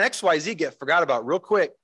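ordinary delivery

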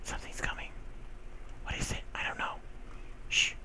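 tense hushed whisper. Acted. Gamer whispering as a mob approaches. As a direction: whisper as if something's near.

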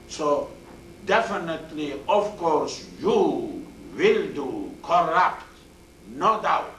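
definitely and doubt hit high and loud, will do corrupt drawn out slowly; teacher showing an emphatic sentence, loud and slow. Shown as a demonstration. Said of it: "Very emphasized word, emphasized sentence".

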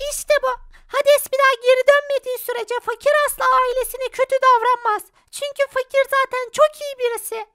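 high, gentle advice; high spirit voice. Acted. Selena telling Fakir to be kind, high voice. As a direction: soothe him in a high voice.

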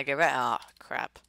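ordinary talk, nothing marked

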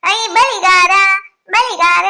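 loud, drawn-out hail; calling out. Acted. Village woman hailing the bangle seller, calling out. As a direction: shout to catch the seller's attention.

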